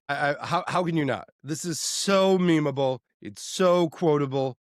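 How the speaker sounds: ordinary talk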